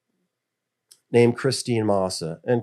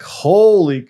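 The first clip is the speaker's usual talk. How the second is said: loud, high shocked outburst; loud gasp of disbelief; shocked exclamation; 8 semitones higher, 11 dB louder than usual. Retold or imitated. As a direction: blurt it out in disbelief. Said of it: "I'm like".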